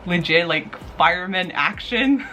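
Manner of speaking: ordinary delivery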